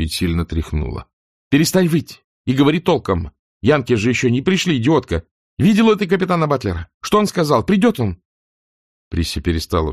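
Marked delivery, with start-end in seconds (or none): 1.5-8.2 sharp, high, impatient scolding; angry shouting. Acted. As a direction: snap her out of hysterics. Shakes panicking maid, shouts. (сердитый окрик)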